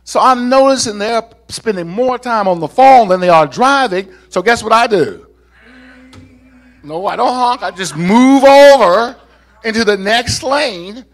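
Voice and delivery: loud, high, punchy pulpit storytelling; forceful preaching. Real, not acted. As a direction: drive the anecdote home to the congregation. Preaches the driving anecdote.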